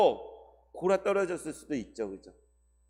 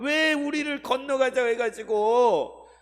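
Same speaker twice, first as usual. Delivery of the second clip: resentful complaint; 6 semitones higher, 4 dB louder than usual. Acted. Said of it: "예수님 원망".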